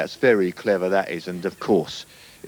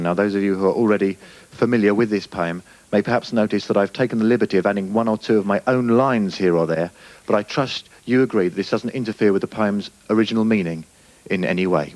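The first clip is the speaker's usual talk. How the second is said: usual speaking voice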